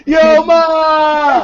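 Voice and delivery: high, loud mock holler down the block; mock catcall. Mocking a street catcaller, hollering at a girl. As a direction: yell it like a guy on the corner.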